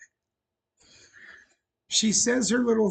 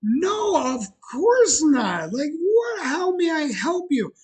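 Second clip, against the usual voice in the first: higher, bubbly over-the-top welcome; imagined reply, pitched up and delighted; eager cheerful reply; 7 semitones higher, 3 dB louder, slower than usual. Retold or imitated. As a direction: gush like a thrilled customer-service rep. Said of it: "In my head, I was like".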